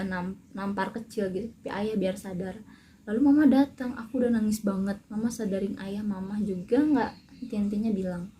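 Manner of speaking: normal talking voice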